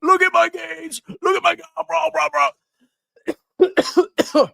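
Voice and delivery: very high squealed bragging; hammed-up shrieking bro parody, far above normal pitch; Lafufu. Streamer parodying a rival showing off gains, in a high mock shriek. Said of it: "So if I was Lafufu ... I'd be like".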